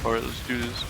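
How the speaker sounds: lightly done monotone YouTuber; mild fast flat intro; a hint of deep voice review host; Critical impression. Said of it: "impressions of YouTubers".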